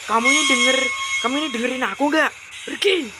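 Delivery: high, loud demand to be obeyed; angry yelling. Acted. Husband ordering his wife away, yelling angrily. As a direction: yell the wife out of the room.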